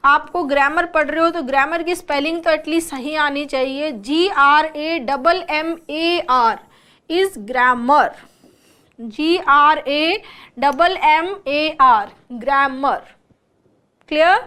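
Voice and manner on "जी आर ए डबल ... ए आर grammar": slow, letter by letter; spelling out. Shown as a demonstration. Teacher showing students the right spelling of grammar, slow spelling out. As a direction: spell each letter slowly, then say the word deliberately.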